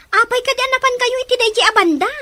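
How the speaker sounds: high, loud and fast; urgent outburst. Acted. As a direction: blurt it out in a rush. Urgent question after sigh. (napigsa ken napartak a saludsod)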